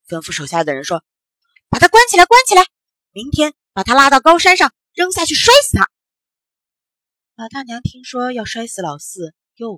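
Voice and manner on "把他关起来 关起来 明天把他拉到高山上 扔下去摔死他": high, very loud raging official; furious ordering. A narrator's reading. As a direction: bellow orders in a rage. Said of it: "又是吹胡子又是瞪眼睛的". (气急败坏地高声喝令)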